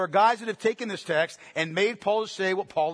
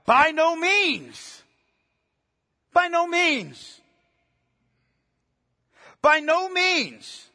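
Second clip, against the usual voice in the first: high, drawn-out exclamation; emphatic preacher's cry of rejection; forceful, emphatic refusal; 5 semitones higher, much slower than usual. Acted. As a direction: thunder Paul's refusal, stretching each word. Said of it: "Paul responds so forcefully and so emphatically".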